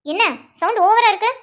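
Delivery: sharp rebuke. Acted. Constable rebukes shouting man. (கடுமையான கண்டிப்பு)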